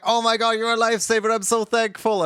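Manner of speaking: high, gushing, overjoyed thanks. Retold or imitated. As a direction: squeal it like they're overjoyed. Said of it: "And they're like".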